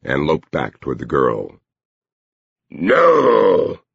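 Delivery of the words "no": higher, stretched warning growl; talking dog. A narrator's reading. Dog bars the girl's way, high drawn-out warning. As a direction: draw the word out like a guard dog. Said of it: "the dog told her".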